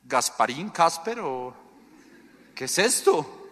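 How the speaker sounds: mock baffled question about a ghost; incredulous disciple. Preacher imitates disciples seeing Jesus on water, mock bewilderment. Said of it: "hubiera dicho lo mismo que dijeron ellos".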